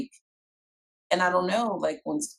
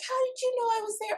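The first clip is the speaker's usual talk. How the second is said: put-on squeaky child's voice, rushed and very high; high fast kid voice; much faster than usual. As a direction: ask it like a surprised little kid.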